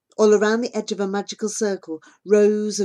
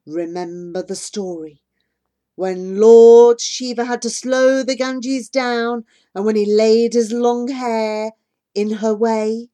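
rumbling mountain spirit; 3 semitones higher, 7 dB louder than usual. A narrator's reading. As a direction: proclaim it like an oracle. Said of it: "It's low and cavernous".

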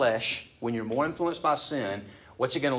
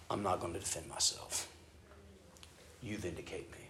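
softer, lower surrendered prayer; quiet trusting prayer; 4 semitones lower, 11 dB quieter than usual. Pretend. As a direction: hand it over to God in a hush.

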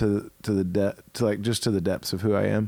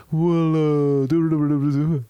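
louder, put-on complainer voice; Thomas rant voice; 3 semitones higher, 3 dB louder than usual. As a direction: imitate the rant voice. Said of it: "really fun little Thomas voice".

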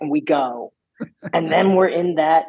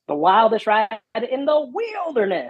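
high, climbing quote; quoting the announcer; 8 semitones higher than usual. Retold or imitated. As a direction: rise up into wilderness. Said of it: "'cause he goes".

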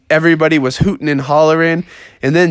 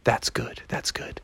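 barely audible whisper; hushed approval; 5 semitones lower, 14 dB quieter than usual. Acted. Froggy approves of mom's lowered singing, whispering. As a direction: whisper it, fading away.

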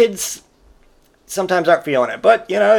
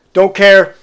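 put-on sullen kid retort; 5 semitones higher, 7 dB louder than usual. Son brushes dad off.

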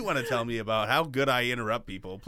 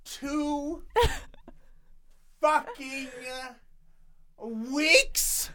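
much higher, slow disbelief; incredulous; 11 semitones higher, much slower than usual. Real, not acted. Co-host stunned by the quick engagement, high and slow. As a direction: stretch it out in shock. Shocked at fast proposal.

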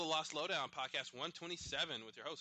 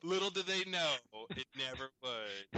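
louder, ominous trailer narrator; suspenseful crime show narrator; 4 dB louder than usual. Acted. Continues the mock narration.

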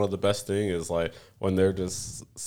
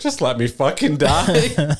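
mock weary plea; 7 semitones higher, 5 dB louder, slower than usual. Kazuma's weary reply.

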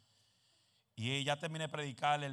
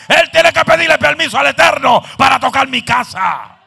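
loud, high fervent sermon shouting; preaching at full force; 12 semitones higher, 27 dB louder than usual. Acted. Preacher roaring to the congregation about his house.

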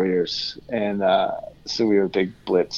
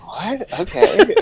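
high, laughing what, okay; confused surprise; 5 dB louder, slower than usual. Retold or imitated. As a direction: react baffled and amused. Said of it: "we'd be like".

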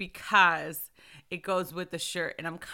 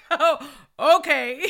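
slow, high, laughing oh okay; giggling acceptance; 8 semitones higher, 9 dB louder, slower than usual. Retold or imitated. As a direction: laugh through a resigned okay. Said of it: "I'm like".

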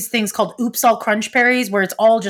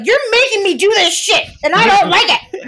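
put-on higher, whiny toddler protest; 11 semitones higher than usual. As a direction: pout like a cranky toddler. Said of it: "I was like the toddler who's like".

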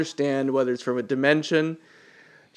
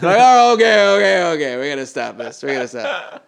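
loud, higher startled then settling down; alarmed parent; 4 semitones higher, 6 dB louder than usual. Retold or imitated. Parent seeing a kid pull a machete, loud alarm fading to firm.

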